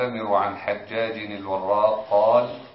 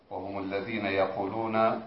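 usual speaking voice

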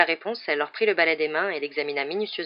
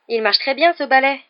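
higher, louder anxious pleading; slightly trembling protest; 8 semitones higher, 8 dB louder than usual. A narrator's reading. Boy protesting to professor about broom, slightly trembling. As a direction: protest with a touch of wobble, voice raised. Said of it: "la voix un peu tremblante".

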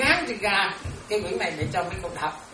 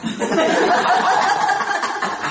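a breathy, falling burst of laughter; loud laugh; 10 semitones higher, 5 dB louder than usual. Real, not acted. Nun laughing at the disciple who fears her. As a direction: let out a big laugh.

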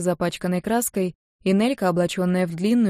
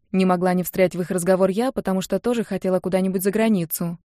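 ordinary delivery, nothing marked

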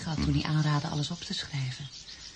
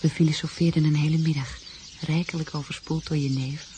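usual speaking voice

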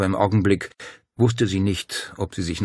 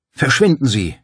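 loud, raised dismissal; angry rebuke; 7 semitones higher, 8 dB louder than usual. A narrator's reading. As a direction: lash out and send him away. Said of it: "fuhr Paul ihn an".

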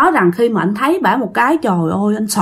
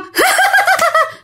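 very high ghostly cackle; eerie cackle. Retold or imitated. Ghost mother laughs.

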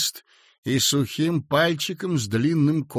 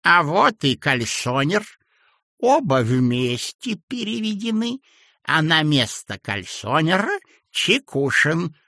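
put-on mumbling old man; 4 semitones higher, 3 dB louder than usual. A narrator's reading. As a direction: mumble it with gummy mouth.

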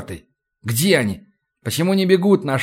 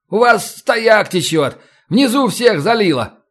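brazen lie; 7 semitones higher than usual. Acted. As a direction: push the lie boldly. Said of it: "Нагло вру я".

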